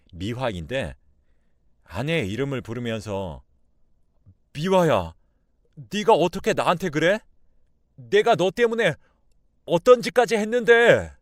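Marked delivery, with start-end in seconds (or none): 4.5-11.1 loud, high accusing cry; betrayed outburst. Acted. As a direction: build from calling out to a yelled reproach. Intruder confronts wife over her betrayal. (배신감에 언성을 높여 따지기)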